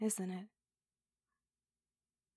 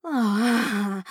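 loud ecstatic cry; 5 semitones higher, 15 dB louder than usual. Acted. Climactic moaning outburst.

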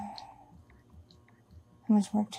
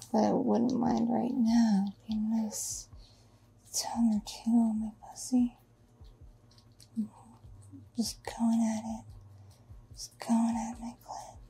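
whispering; 3 dB quieter than usual. Acted. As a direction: whisper it close to the mic. Intimate whispered fantasy.

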